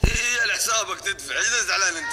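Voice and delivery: teasing the friend stuck with the bill. Pretend. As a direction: gloat at the one paying. Teasing friend who pays.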